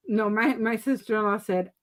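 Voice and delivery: ordinary talk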